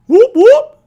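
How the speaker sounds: high loud whoop whoop like a siren; police siren imitation. Telling viewers about getting pulled over, imitating a loud high police siren. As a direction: yelp it out like a police car siren.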